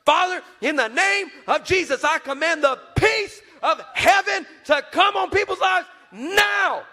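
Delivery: high, loud, forceful declaring; commanding prayer. Retold or imitated. Young daughter praying over viewers, high and forceful. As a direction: declare it with full power.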